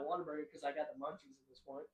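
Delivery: ordinary talk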